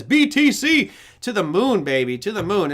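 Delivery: high, loud opening, easing off; hyped crypto fan. Acted. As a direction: chant it like a rallying cheer. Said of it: "As Chris says".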